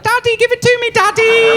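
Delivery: put-on high, loud accented "Daddy, give it to me"; mock his accent, way up high; imitating a foreign accent. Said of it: "He had an accent".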